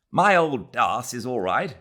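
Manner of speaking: ordinary delivery, nothing marked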